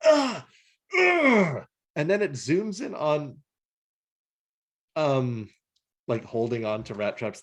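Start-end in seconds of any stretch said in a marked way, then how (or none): 0.0-1.6 high, loud pained grunts; mock pained grunts. Retelling the cartoon scene, mock pained grunts. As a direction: grunt it out like a hurt cartoon robot. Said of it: "and he goes".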